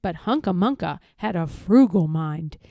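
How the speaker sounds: ordinary delivery, nothing marked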